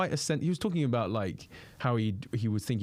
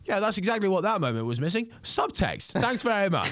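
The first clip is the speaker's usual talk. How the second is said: much higher, mock deadpan comeback; dry comic reply; 10 semitones higher than usual. Comedian answering the heckler, high mock deadpan. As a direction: play the comic's wry put-down, pitched well up. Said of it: "he was like".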